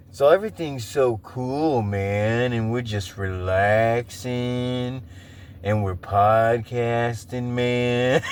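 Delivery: low, slow, laid-back stoner drawl. Retold or imitated. Imagining the podcast guest as [name] and [name], slow stoner drawl. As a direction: drift through it like a relaxed stoner. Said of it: "[name] and [name] kinda voice".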